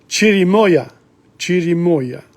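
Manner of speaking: chirimoya with a hard ch at the start. Shown as a demonstration. Teacher showing the ch sound in chirimoya. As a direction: say chirimoya, ch as in church. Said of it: "But C H is ch".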